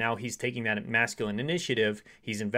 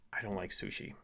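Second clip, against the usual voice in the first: soft feminine sharing; 2 semitones lower, 11 dB quieter than usual. Acted. Woman sharing a dislike with her man, softly and gently. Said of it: "share it instead in a feminine way".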